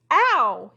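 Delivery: a loud sharp ow; pained yelp. Acted. Crocodile hit in the ankles yelps, loud and sharp. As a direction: yelp as the rope stings. Rope hits his ankles.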